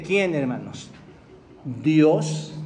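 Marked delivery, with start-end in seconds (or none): 1.8-2.5 emphatic answer. Real, not acted. Answering his own question to congregation.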